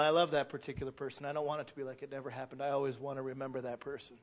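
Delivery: ordinary talk, nothing marked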